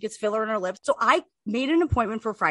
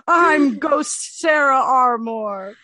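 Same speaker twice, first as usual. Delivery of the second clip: slow, louder ghostly moan; spooky ghost voice; 2 semitones higher, 6 dB louder, much slower than usual. Acted. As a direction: introduce yourself as a ghost. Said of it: "That's my spooky ghost voice".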